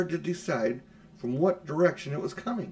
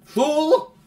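much higher, much louder outraged cry; furious shout; 11 dB louder than usual. A narrator's reading. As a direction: spit it out like an enraged witch. Witch catches the treachery.

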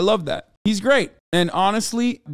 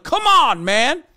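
high, exasperated yell; re-enacted frustrated shout, pitched way up; 8 semitones higher, 4 dB louder, slower than usual. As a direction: holler at the screen in frustration. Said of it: "I'm literally yelling at the T V".

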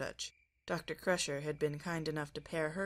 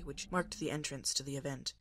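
plain reading voice

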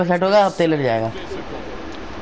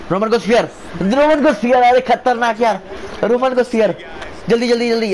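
excited shout; 5 semitones higher than usual. Real, not acted. Hyped calling the finisher.

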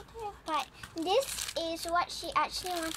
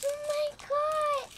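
higher, louder happy cry; excited exclaim; 8 semitones higher, 4 dB louder than usual. Real, not acted. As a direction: still thrilled by the surprise. Still reeling from surprise.

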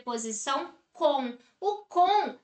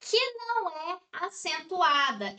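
normal talking voice

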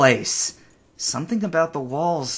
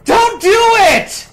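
much higher, louder, slow pleading yell; anguished drawn-out cry; desperate shout; 12 dB louder, slower than usual. Real, not acted. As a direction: scream a warning they can't hear. Begging rescuers not to come.

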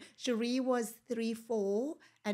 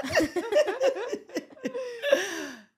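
loud, high peal of laughter; real, drawn-out cackling laugh; helpless laughter; 13 semitones higher, 10 dB louder than usual. Real, not acted. Friend cracking up at her own mix-up, helpless laughter.

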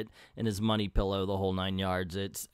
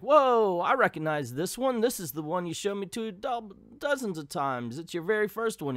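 higher-pitched, mildly amazed recognition; Donald Duck; 6 semitones higher than usual. Acted. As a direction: play a surprised nephew, lightly acted. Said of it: "And Donald's like".

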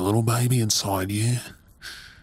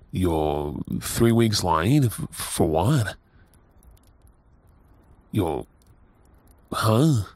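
slow stunned disbelief; 4 semitones lower, much slower than usual. Acted. Partner realizes she may be pregnant.

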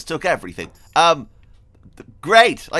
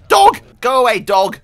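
shouting; 8 semitones higher, 9 dB louder than usual. Real, not acted. Attacked by a dog.

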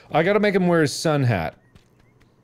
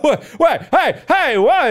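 high, loud outburst; pitched-up indignant yelling; yelled protest; 10 semitones higher, 7 dB louder, slower than usual. Pretend. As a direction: fire off shouted protests. Goose protesting in the game.

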